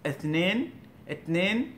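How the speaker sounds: "ইসনিন" said as "etnen", t for th; rising "ইসনিন" with a t in place of s; Middle Eastern pronunciation. Shown as a demonstration. Teacher giving Middle Eastern examples, t-like "ইসনিন". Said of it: "এভাবে থা এর মতো উচ্চারণ করতেছে".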